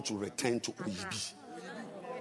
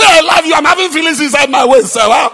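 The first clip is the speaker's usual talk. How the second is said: much higher, much louder; shouted love line; 22 dB louder than usual. Shown as a demonstration. Preacher showing the wrong, loud way to say I love you. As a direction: bellow it out. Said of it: "It's rebukes which are loud".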